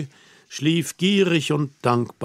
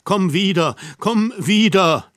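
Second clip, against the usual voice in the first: loud, higher, begging her to stay; pleading; 4 semitones higher, 4 dB louder than usual. Acted. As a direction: plead anxiously, building to the last komm wieder. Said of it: "rief ich flehend". (laut und flehend, ängstlich bittend)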